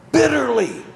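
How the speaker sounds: "Bitterly" called out high and loud; emphatic answer. Real, not acted. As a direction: punch "Bitterly" as the key word.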